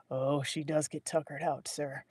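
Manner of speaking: lightly voiced raspy assistant; a hint of a rasp, soft. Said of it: "A raspy voice followed".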